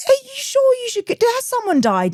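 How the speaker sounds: can't believe he hasn't gone; stunned comic asking the boyfriend why he won't go; incredulous. Retold or imitated. Quoting herself at the boyfriend, baffled.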